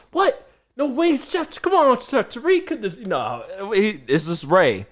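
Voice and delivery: very high, loud outraged barber; imitating Ray, shocked. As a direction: squeal in disbelief over no wings. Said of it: "He's like".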